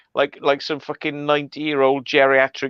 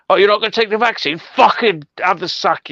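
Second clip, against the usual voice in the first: higher, fast, scornful mock rant; mock angry boss; 8 semitones higher, 3 dB louder than usual. Mocking the government for sacking NHS staff, a scornful mock rant.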